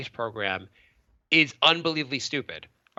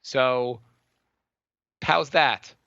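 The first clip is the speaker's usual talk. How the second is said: usual speaking voice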